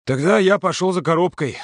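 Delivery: high-pitched, emphatic declaration; firm announcement. A narrator's reading. Yurka breaks up the quarrel by announcing his errand, emphatically. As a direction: announce it without asking. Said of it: "а объявил".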